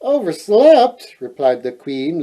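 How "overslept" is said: higher, louder, slow puzzled echo. A narrator's reading. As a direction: question the word in disbelief, drawn out. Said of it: "looking puzzled".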